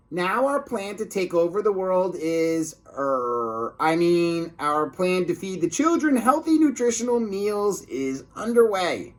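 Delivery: high put-on sneaky lunch lady; high-pitched scheming cafeteria lady. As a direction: play the disguised villain lady, sweet and fake. Villain slips up.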